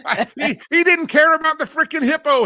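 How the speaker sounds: high, loud laughing disbelief. Real, not acted. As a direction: crack up while telling it.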